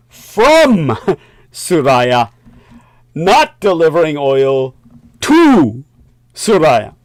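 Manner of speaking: "from" and "to" hit hard and loud; emphatic stress. Acted. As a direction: punch "from" and then "to". Stressing the sanctions' exact wording.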